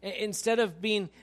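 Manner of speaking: preaching at full force. Acted. Pastor urging his church to open its doors, loud preaching. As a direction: drive the point home to the church.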